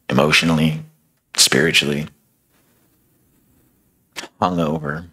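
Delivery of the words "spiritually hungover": usual speaking voice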